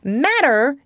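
high, indignant yelp; yelling. A narrator's reading. Father answers about the matter, yelling. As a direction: throw the word back in outrage. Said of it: "he yelled. He really yelled".

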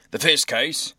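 loud official call; judge calling a case. A narrator's reading. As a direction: announce it to the whole courtroom. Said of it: "repeated Judge Obadiah".

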